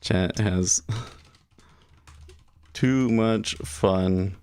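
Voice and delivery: "Chat has too much fun" drawn out word by word, very slow; slow dictation while typing. Shown as a demonstration. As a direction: spell it out slowly as you type the title. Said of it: "Change the title of this to".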